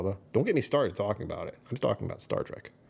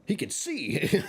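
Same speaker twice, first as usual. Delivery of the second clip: mock amazement; 4 semitones higher, slower than usual. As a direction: voice climbing much higher into a stunned gasp. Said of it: "I was like".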